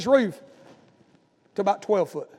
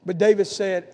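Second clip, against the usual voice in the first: normal talking voice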